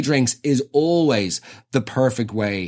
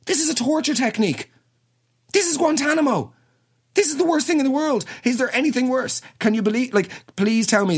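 exasperated rant; 10 semitones higher than usual. Real, not acted. Exasperated rant about comedy video.

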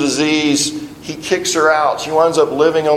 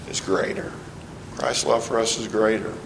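whispered awe, much softer; hushed emphasis; 5 semitones lower, 11 dB quieter than usual. Real, not acted. Preacher to congregation, hushed and awed. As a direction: drop to a near whisper to land it.

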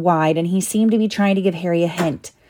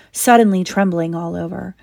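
usual speaking voice

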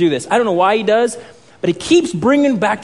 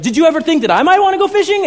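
indignant, high, rushed and loud; Daniel; 10 semitones higher, 5 dB louder, faster than usual. Retold or imitated. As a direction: fire off an aggrieved complaint. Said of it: "he just unloads on Mister Miyagi".

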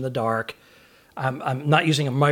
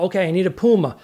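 louder, called out to a big crowd; calling out; 5 semitones higher, 6 dB louder, faster than usual. Retold or imitated. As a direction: project it to the back rows.

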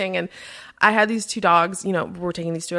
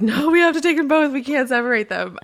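emotional insistence; 6 semitones higher than usual. Retold or imitated. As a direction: insist tearfully that the pair stays together. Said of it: "Nick and I, like".

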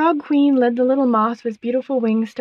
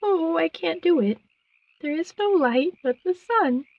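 high, plaintive little voice; tearful moth; 6 semitones higher than usual. A narrator's reading. Moth who can't find any light, crying. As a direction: lament it in a high, pitiful voice. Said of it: "lower her wings and begin to cry".

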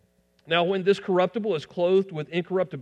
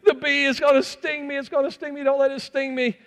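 put-on high, frantic child's pleading; retold panicked plea, pitched way up; imitated frightened daughter; 9 semitones higher than usual. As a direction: act out a little girl begging in panic. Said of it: "his daughter is saying".

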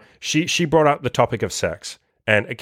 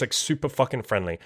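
normal talking voice

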